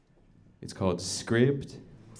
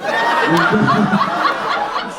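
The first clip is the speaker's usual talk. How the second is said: burst of laughter; 11 semitones higher, 9 dB louder than usual. Real, not acted. Laughing at own impression.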